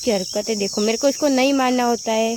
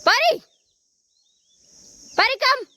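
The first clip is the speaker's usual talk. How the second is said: high, piercing call of the dog's name; sharp call; slower than usual. Real, not acted. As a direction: call Pari back at once. Calling the dog sharply.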